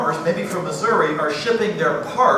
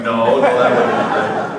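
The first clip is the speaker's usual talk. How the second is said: laughing protest; 7 semitones lower, 3 dB louder than usual. Retold or imitated. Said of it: "they're all going".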